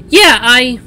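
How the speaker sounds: high, loud, keen character voice; eager young sister. A narrator's reading.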